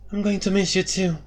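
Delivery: Elara says goodbye to a friend; Elara, fond. A narrator's reading.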